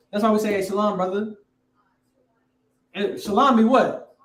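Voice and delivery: usual speaking voice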